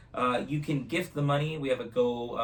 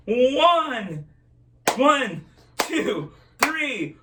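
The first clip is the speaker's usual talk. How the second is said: loud countdown; 12 semitones higher, 9 dB louder, much slower than usual. Real, not acted. Counting off for the crowd.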